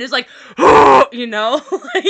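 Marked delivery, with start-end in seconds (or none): 0.3-1.1 loud breathy whoosh; gust of wind sound. Shown as a demonstration. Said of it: "that's the gust of wind".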